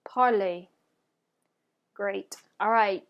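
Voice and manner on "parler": "parler" as "par-lay", final R silent; French pronunciation. Shown as a demonstration. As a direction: model "parler" with its ending rhyming with play. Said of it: "repeat after me".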